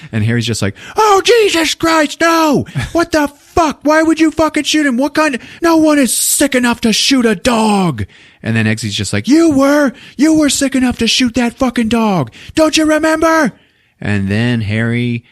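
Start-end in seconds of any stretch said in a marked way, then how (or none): 1.0-8.0 high, loud shrieking falsetto; horrified shriek. Retold or imitated. Harry horrified at Eggsy. 9.3-13.5 high, loud falsetto accusation; accusing shriek. Retold or imitated. Eggsy accusing Harry.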